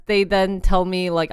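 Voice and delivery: usual speaking voice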